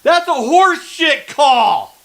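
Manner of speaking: loud, high, furious shouting at the refs; furious yelling. Real, not acted. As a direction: yell it out, outraged at a bad call. Fan furious at referee's flag.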